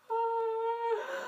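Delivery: loud high yelp of frustration; frustrated yelp. Real, not acted. Vlogger yelping over the lost Sims game, loud high yelp. Groans about never playing game.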